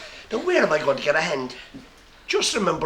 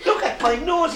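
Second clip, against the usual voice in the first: high, laughing repeat; high giggly second look; 11 semitones higher, slower than usual. Acted. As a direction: still giggling, look again. Doctor admiring pig's nose.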